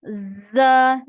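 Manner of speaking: "जा" said loud and forceful with a hiss; loud drilled "जा" for the class; forceful letter demo. Shown as a demonstration. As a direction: hit "जा" hard, teacher modelling. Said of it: "इसको आपने जोर से अदा करना है".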